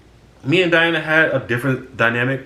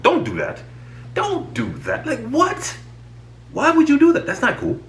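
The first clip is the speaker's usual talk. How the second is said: much higher, incredulous whine of protest; exasperated. Retold or imitated. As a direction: squeak it out in disbelief at a friend. Exasperated scolding of friend.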